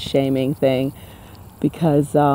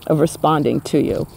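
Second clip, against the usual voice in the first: ordinary talk, nothing marked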